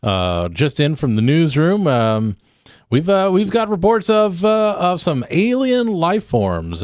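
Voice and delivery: upbeat on-air DJ, high and bright; radio DJ voice. Acted. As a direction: hype it like a morning radio host.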